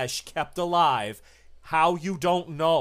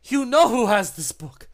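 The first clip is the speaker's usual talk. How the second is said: much higher and louder, pleading protest; high protesting creature; 10 semitones higher, 6 dB louder than usual. Acted. The creature protests to Arturo, high and loud. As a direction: push back desperately. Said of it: "She says".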